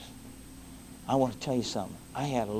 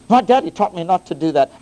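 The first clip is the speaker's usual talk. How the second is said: mock excuse; 8 semitones higher, 14 dB louder than usual. Mocks a listener's excuse.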